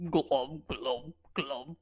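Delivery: low gurgling fish creature; gurgling fish man croak. Acted. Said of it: "fish man avatar gurgles".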